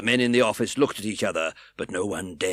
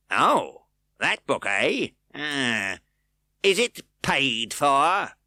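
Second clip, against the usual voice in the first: insinuating; 6 semitones higher, 5 dB louder, much slower than usual. A narrator's reading. Fang insinuating.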